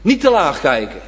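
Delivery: usual speaking voice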